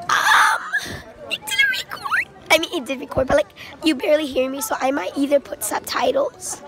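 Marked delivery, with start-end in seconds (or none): 0.1-2.3 sudden high yelled um; frustrated shout. Real, not acted. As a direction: blurt it out in frustration. Frustrated about failed recording.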